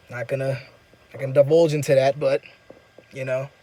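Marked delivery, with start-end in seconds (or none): none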